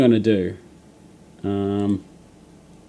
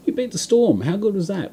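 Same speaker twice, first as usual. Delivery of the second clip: excited cheer; 9 semitones higher than usual. Real, not acted. Celebrating his team's win.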